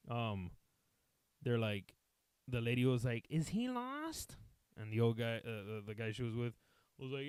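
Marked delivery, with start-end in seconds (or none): 3.3-4.4 high, slow, drawn-out question; woman bystander. Retold or imitated. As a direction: imitate a curious lady, voice climbing high. Woman's question acted in story.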